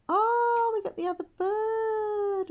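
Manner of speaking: high, loud delighted cry; high squeal of delight; excited squeal. Real, not acted. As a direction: burst out with joy at the bird. New bird hatches.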